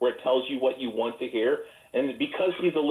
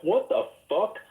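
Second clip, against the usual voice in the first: higher-pitched mock astonishment; incredulous, pitched up; retold disbelief; 7 semitones higher, slower than usual. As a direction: act out being baffled by what you heard. Retells shock at changed voice.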